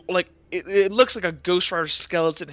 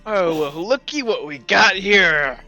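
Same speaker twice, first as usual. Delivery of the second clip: slow, drawn-out gloating greeting; Tom; slower than usual. Acted. Tom walking over to the two players, slow taunting greeting. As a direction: take your time, relishing having caught them.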